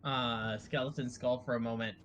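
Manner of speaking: ordinary delivery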